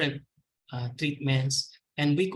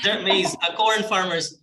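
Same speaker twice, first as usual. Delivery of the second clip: laughing; 4 semitones higher, 7 dB louder than usual. Real, not acted. Laughs after the misheard word.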